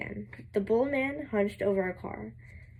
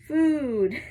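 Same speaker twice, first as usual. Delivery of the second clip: moaning; 5 semitones higher, 7 dB louder than usual. Acted. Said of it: "Grover moaned".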